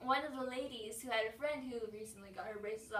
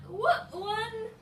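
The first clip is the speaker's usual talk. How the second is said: mock cheer; 9 semitones higher, 5 dB louder, slower than usual. Retold celebrating.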